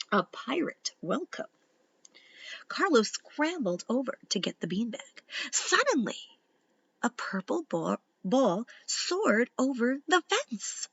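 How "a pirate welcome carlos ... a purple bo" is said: ordinary delivery, nothing marked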